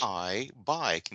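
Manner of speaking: "I, bike" with a gliding ai sound; English diphthong demo. Shown as a demonstration. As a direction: glide from a to i in "bike". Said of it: "aqui, ó".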